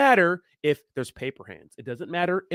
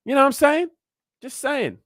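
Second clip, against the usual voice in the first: high, loud heated challenge; salty and fired up; 10 semitones higher, 4 dB louder than usual. Real, not acted. Vlogger venting at haters, fired up and loud. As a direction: throw it at the haters, worked up. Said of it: "I'm getting fucked salty here".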